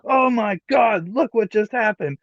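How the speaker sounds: a touch of hammed-up excitement; excited commentator. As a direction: stretch out the shock like a commentator. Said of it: "and it's like".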